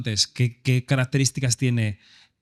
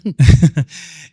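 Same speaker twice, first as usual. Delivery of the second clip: short laugh; 3 semitones higher, 9 dB louder than usual. Real, not acted. Laughing at guest's joke.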